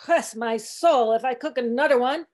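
put-on mildly angry old-cook grumble; angry growl. A narrator's reading. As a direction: growl it, drawing out the first words. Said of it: "he growled angrily".

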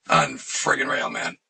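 ordinary delivery

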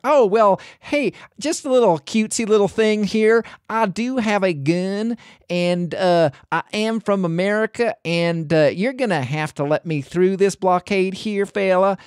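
high, put-on North American accent; Virginia. Reviewer mimics the gun-carrying woman at a blockade, mock American accent. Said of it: "her accent annoyed me the entire time".

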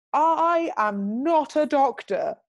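slow, high public declaration; drawn-out, high proclamation; mock announcement. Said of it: "So I just announce".

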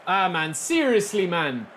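usual speaking voice

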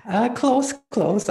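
high, loud, laughing through the words. Real, not acted. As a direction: burst out amused. Amused reaction to her name.

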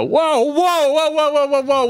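high, loud repeated whoas; alarmed whoa. Retold or imitated. Husband stopping his wife from swearing, high and loud. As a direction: hold the wife back in a high voice. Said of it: "And then I go".

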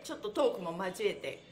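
ordinary talk

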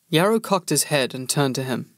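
ordinary delivery, nothing marked

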